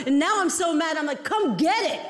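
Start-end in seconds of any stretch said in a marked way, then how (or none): 1.2-2.0 higher defiant challenge; mock angry dare. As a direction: dare her, fed up.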